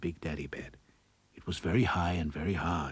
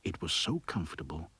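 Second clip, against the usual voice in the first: soft whispered narration as she drifts off; hushed whisper; 5 dB quieter than usual. A narrator's reading. Narrator whispering as Goldilocks falls asleep, hushed. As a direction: whisper it like a lullaby.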